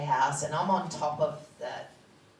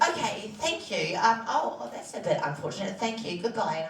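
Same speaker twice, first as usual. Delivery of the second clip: much higher and louder, brittle polite calm; forced politeness; 6 semitones higher, 3 dB louder than usual. Retold or imitated. Patient just told she has cancer, brightly thanking the doctor, much higher and louder. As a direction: chirp the thanks to hide the shock.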